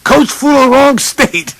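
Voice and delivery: high, loud mock yell to a coworker; yelling across the airport. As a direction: holler the news to Bert, hammed up. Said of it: "stepped back and yelled".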